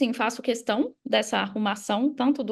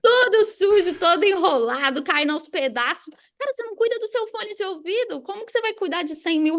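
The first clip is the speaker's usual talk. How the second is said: playful scolding; 7 semitones higher than usual. Real, not acted. Teasing the host about his headphones, playful higher scolding. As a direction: tease him like you caught him red-handed. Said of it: "Vai apanhar agora".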